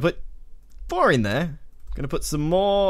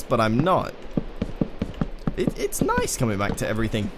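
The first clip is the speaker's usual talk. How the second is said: ordinary delivery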